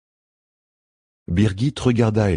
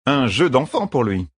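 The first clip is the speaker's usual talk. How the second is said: slow, higher-pitched sneer; mock him, drawing the words out; a touch of scornful teasing; mocking jibe; 6 semitones higher than usual. A narrator's reading. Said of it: "railla Birgit".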